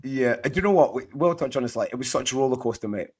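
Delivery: ordinary talk, nothing marked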